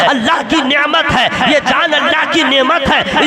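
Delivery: loud, driving Urdu sermon; fiery preaching. Acted. Preacher rousing the congregation, loud and fervent. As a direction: preach it hard to the crowd.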